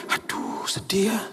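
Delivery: hushed, whispered lament; mock mourning. Mourner reacting to someone called home, hushed mock sorrow. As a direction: drop to a soft whisper, acting bereaved. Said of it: "orang berduka".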